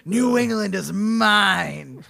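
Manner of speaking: loud, high, drawn-out monster proclamation; stretched-out villainous roar. Acted. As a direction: bellow it slowly like a conquering beast. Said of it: "He's like".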